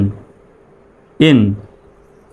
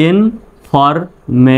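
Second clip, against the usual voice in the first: Information very slowly, in four separated pieces; syllable by syllable; 3 semitones higher, much slower than usual. Shown as a demonstration. Teacher showing how the whole word sounds, syllable by syllable. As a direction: break information into in, for, me, shan. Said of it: "তাহলে উচ্চারণটা কেমন হচ্ছে?".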